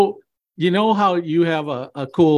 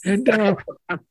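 put-on high, thin old uncle voice; old man voice; 9 semitones higher than usual. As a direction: play a frail old uncle, pitched up. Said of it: "Uncle Dave joins and goes".

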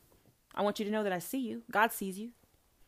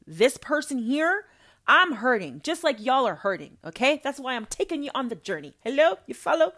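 rousing preach; 4 semitones higher, 7 dB louder than usual. Acted.

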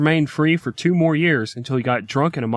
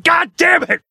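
high, loud exasperated cry; mock exasperated yell; frustrated outburst; 12 semitones higher, 4 dB louder than usual. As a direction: blow up like a man who can't win. Frustrated defendant cornered by judge.

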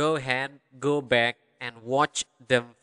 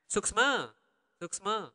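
suksma in a lilting Balinese accent, higher; 5 semitones higher than usual. Shown as a demonstration. Teacher showing own accent on thank-you, Balinese accent. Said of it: "That would be the accent, my accent".

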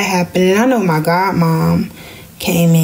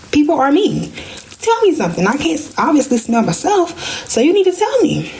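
exasperated plea; 9 semitones higher than usual. Retold or imitated. Lecturing her mom about smell.

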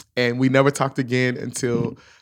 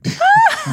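loud laugh; 8 dB louder than usual. Real, not acted. Recalling her silent car ride, a loud high laugh.

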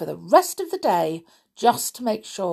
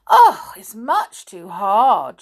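louder, drawn-out grumble on the bed; mild complaint; 7 dB louder than usual. A narrator's reading. Goldilocks trying Father Bear's bed, displeased. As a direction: complain with a heavy, slow "oh".

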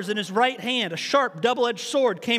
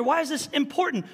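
normal talking voice

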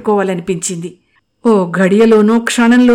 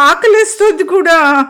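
high, louder laughing and drawn out; laughing drawl; 10 semitones higher, 5 dB louder than usual. Acted. Anu teasing Mukul about being hungry, laughing drawl. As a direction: stretch it out teasingly. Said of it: "నవ్వుతూ సాగదీసింది".

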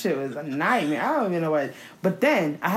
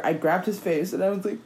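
normal talking voice

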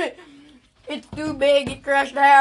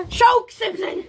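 shouted through laughter; laughing shout; 8 semitones higher, 3 dB louder, slower than usual. Real, not acted. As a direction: yell it while cracking up. Laughing outburst.

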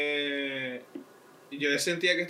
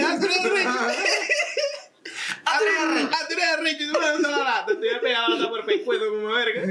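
high, loud laughing mock fury; mock angry comeback; 11 semitones higher, 4 dB louder than usual. Narrator confronting the thug, hammed-up anger. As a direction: fire back like you're ready to fight. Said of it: "Y dije".

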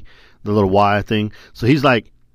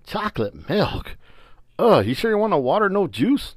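mock surprise; 10 semitones higher than usual. Grandpa incredulous at request.

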